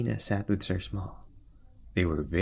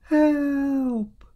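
high, loud cries for help; a lady snatched away, shouting; very high, loud call for help; 7 dB louder than usual. Acted. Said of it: "she shouted".

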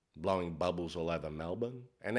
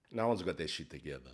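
quiet; 5 semitones lower, 6 dB quieter than usual. Real, not acted. Secret told low.

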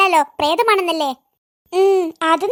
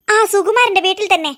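ordinary delivery

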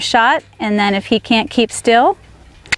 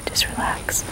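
whispered, much softer; soothing whisper; 12 dB quieter than usual. Real, not acted. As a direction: hush the horse into calm. Soothing the nervous horse.

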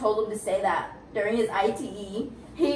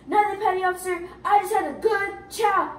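higher-pitched dutiful recruit voice; imitated recruit reply; 5 semitones higher than usual. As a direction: report earnestly to a superior, acted. Acted recruit answering officer formally.

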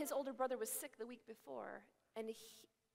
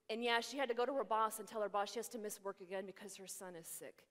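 usual speaking voice

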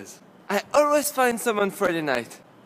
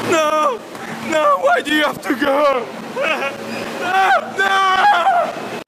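loud wailing no; 9 semitones higher, 5 dB louder, slower than usual. Acted. Dramatic protest at departure.

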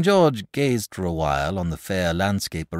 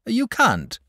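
triumphant; 8 dB louder than usual. A narrator's reading. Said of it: "said the dragon triumphantly".

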